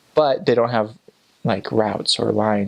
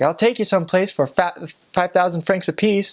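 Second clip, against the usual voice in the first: lightly voiced old cabbie, pitched higher; a hint of the pushy old driver; angry old taxi man; 8 semitones higher than usual. Old cabbie to the missionaries, lightly imitated offer. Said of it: "really angry old man who drove taxis".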